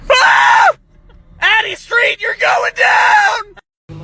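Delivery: loud, high, triumphant yelling; gleeful battle shout. Real, not acted. Gamer calling an airstrike on Addie Street, shouting with glee. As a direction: scream the attack like you're winning the war.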